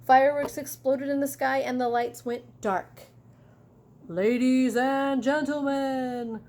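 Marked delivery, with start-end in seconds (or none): 4.1-6.4 arena announcer. Acted. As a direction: announce the monster to the whole arena. Announcer introduces the monster.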